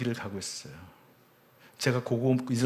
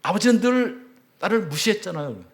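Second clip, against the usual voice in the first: higher and louder, accusing through tears; anguished shout; 8 semitones higher, 6 dB louder than usual. Retold or imitated. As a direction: hurl the hurt at him in a shout. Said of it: "외마디 비명처럼 소리를 지르더라고요".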